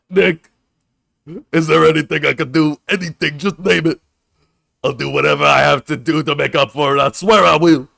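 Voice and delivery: grovel at Nick's feet, overplaying it; groveling apology. Voicing a guilty guard begging Nick, loud and hammed-up. Same groveling character again.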